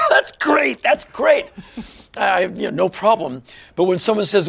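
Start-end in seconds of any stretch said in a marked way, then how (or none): 0.0-1.4 laughed out, high and loud; laughing. Real, not acted. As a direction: brush the insult off through a laugh. Laughing off an insult.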